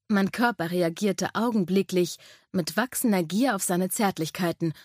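ordinary delivery

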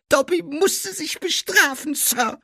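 very high squeaky elf; Dobby. A narrator's reading. Elf explains punishing himself, high loud squeak.

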